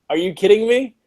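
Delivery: ordinary talk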